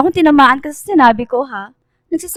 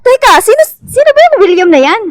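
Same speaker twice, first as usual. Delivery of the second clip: loud confusion; 7 semitones higher, 7 dB louder than usual. Acted. Confused shout amid fight.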